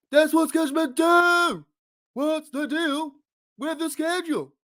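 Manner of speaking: exasperated comic, pitch pushed way up; Jerry Seinfeld. Acted. Fan host riffing on the schedule as an exasperated high-pitched comedian. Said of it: "do my Jerry Seinfeld impression".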